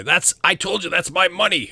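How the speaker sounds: high, loud indignant squabbling; bickering. Acted. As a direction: argue loudly over what's yours. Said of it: "people sort of arguing and bickering".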